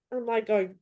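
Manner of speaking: normal talking voice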